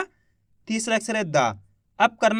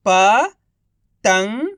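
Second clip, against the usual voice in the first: each syllable drawn out very slowly; slow syllable-by-syllable reading; 4 dB louder, much slower than usual. Shown as a demonstration. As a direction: sound out सुरंग slowly as सु-रं-ग, one sound at a time. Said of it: "एक अक्षर का उच्चारण करके".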